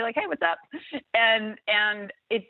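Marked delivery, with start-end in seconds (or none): 0.2-0.6 casual breezy higher hey; friendly greeting. Retold or imitated. Answering a street greeting, friendly and casual. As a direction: toss back a light friendly hello. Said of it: "and say".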